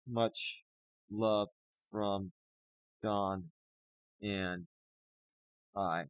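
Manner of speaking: real tearful gratitude; choked thanks. Real, not acted. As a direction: squeeze the words out through tears. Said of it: "I'm losing my shit".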